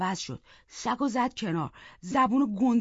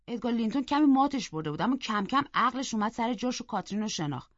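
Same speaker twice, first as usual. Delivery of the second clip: usual speaking voice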